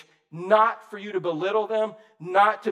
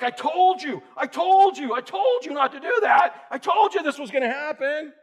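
high, loud, exasperated I-told-you-so; scolding; 7 semitones higher, 4 dB louder than usual. Retold or imitated. Rescuer scolds the person who fell in the ditch, loud exasperated scolding. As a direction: scold the fool in the ditch, pressing every told. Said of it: "What if you're like".

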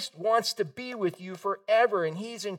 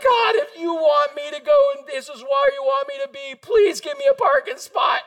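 high, loud mock plea to God; hammed-up desperate prayer; pleading prayer; 5 dB louder than usual. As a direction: beg heaven urgently. Retold urgent prayer for parking.

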